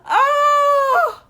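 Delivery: high laughing shout; laughing shriek. Real, not acted. Vlogger cracks up at her own pettiness, shrieking. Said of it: "it's so insane".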